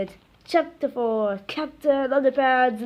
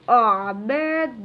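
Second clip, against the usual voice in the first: moaned; 4 dB louder than usual. A narrator's reading.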